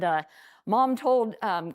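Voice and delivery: ordinary delivery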